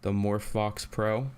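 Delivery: ordinary talk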